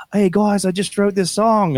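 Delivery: high, drawn-out cartoon bandmate voice; high-pitched cartoon parody. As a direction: announce it high and proud, slowing down. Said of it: "Family Guy clip where he's like".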